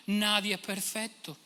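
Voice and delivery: "Nadie es perfecto" drawn out slowly in a whiny mock voice; mock excuse. Preacher ends the mocked excuse, slow and whiny. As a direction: shrug it off slowly, mocking.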